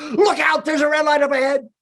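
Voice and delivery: high loud warning yell; nagging passenger. Retold or imitated. Passenger shouting a warning at the driver, high and loud. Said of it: "That person in the front seat goes".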